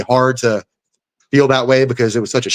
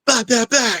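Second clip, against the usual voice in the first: high rapid punch noises; punch sounds; 11 semitones higher than usual. Retold or imitated. Fighter sounding out opponent's punches, high rapid. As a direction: pop out three punch noises.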